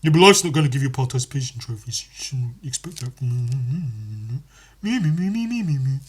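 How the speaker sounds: put-on whiny mimicry, melting into babble; mocking complainer. Mimics complainers, dissolving into gibberish.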